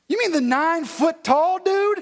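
high-pitched mock disbelief; acted astonished question; incredulous. Incredulous mock reaction to Goliath's name.

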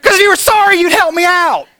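full-voiced, high-pitched indignant scream. Preacher voicing what he held back from the clerk, screaming. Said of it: "not to scream in her face".

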